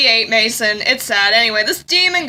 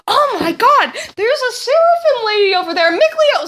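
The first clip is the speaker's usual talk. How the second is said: high-pitched, hammed-up exclamation; acted excitement; 9 semitones higher than usual. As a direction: call out in a put-on high voice. Acted excited sighting of seraphim.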